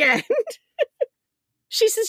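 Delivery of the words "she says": usual speaking voice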